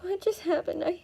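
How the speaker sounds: put-on high, bewildered voice of a rattled girl; shaken girl. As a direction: sound dazed and thrown, pitched well up. Shaken girl after being rescued.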